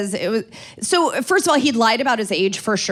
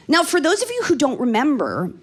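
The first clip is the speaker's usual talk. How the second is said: ordinary talk, nothing marked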